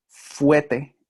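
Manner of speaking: "fuete" said with emphasis, no r before the t; variant pronunciation. Shown as a demonstration. Teacher citing how some people talk, "fuete" without r. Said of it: "algunas personas dicen".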